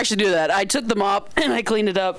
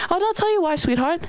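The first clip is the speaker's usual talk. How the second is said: high-pitched imitation of an indignant manager; sassy telling-off; 8 semitones higher than usual. Manager tells customer why she's kicked out, sassy mock telling-off. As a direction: tell her off in a raised pitch.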